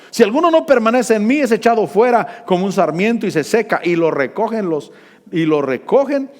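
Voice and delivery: loud, high proclaiming preacher; preaching at full force. Acted. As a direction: ring out the verse from the pulpit. (predicación a todo pulmón)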